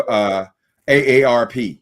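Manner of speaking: ordinary talk, nothing marked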